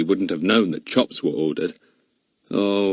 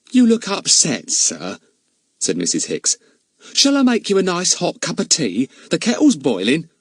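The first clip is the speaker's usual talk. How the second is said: high, chatty housekeeper protesting; 8 semitones higher than usual. Acted. Charwoman contradicting the village policeman, high and eager. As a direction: pitch her up like a bustling charwoman.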